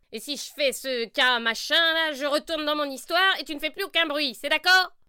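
voix de sorcière aiguë; sorcière qui négocie. Acted. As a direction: propose le marché avec insistance. Sorcière négocie avec lapin. (sorcière qui marchande)

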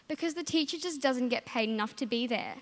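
normal talking voice

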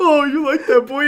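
high, loud cooing at a dog; hammed-up dog-lover coo; doting pet voice. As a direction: talk to him like a good boy. Wrestler petting wolf man.

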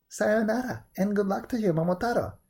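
high-pitched called-out goodbye; cried farewell. A narrator's reading. Old couple sending Momotaro off on his journey, crying farewell. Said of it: "cried the old man and the old woman".

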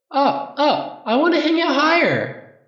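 put-on very high, eager child's voice; excited little boy. A narrator's reading. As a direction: beg like a small child. Small son begging his father.

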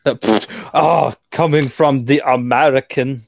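voicing Cry. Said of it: "I'm being, uh, Cry right now".

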